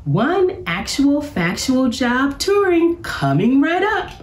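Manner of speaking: high, showman-like announcer; professor voice. A narrator's reading. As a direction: announce the tour grandly. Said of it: "said the professor".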